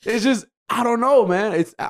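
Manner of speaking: usual speaking voice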